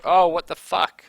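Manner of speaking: sudden loud shocked cry; startled outburst. Real, not acted. Startled by enemies.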